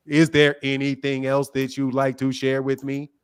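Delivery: low, even robotic monotone mimicking the caller; mock them in a deep one-tone robot voice. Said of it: "anybody that talks like this".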